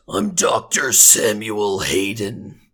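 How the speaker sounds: very deep, slow, metallic robot growl; intimidating robot, drawing every word out low; growly robot voice. Shown as a demonstration. Son shows off his redubbed game character, growly robot voice. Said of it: "learn how to do kind of a growly robot voice".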